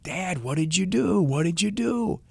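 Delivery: put-on high, alarmed kid's voice; a young boy pleading with his dad; boy's voice. Boy cries out to dad over the dying doe, high and alarmed. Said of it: "the boy is like".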